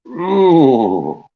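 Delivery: high contented mmm; savoring hum. Real, not acted. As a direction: hum with pleasure over food. Eating cookies, pleased.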